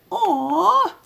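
squeaky high Aw with a funny face; son's goofy joke, very high Aw. Retold or imitated. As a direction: pitch the Aw way up like a goofy kid. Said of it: "But this was more like".

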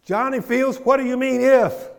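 high, loud indignant shout; acted upset outburst. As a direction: build to a booming reprimand, as if calling someone out. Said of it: "He got upset".

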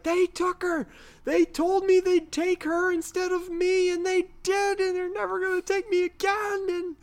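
high, strained, half-shouted pleading; a panicked man insisting he saw it; frantic abductee. Retold or imitated. Duane Barry voiced in retelling.

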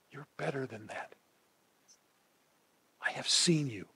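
soft, tender affirmation, almost a whisper at times; gentle encouragement. Shown as a demonstration. Preacher showing wives how to talk to a husband, soft and tender. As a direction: speak life into him quietly and kindly. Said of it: "here's how you yell at your husband".

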